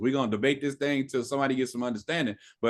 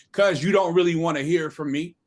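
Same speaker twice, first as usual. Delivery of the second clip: usual speaking voice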